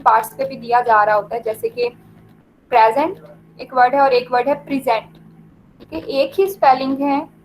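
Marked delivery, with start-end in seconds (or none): 2.7-3.2 "present" as PREZ-ent, weight on the first syllable; first-syllable stress. Shown as a demonstration. Teacher contrasting two words, "present" stressed at the start. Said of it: "एक के start में". 4.5-5.1 "present" as pri-ZENT, weight on the second syllable; second-syllable stress. Shown as a demonstration. Teacher contrasting two words, "present" stressed at the end. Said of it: "एक के second syllable पे stress".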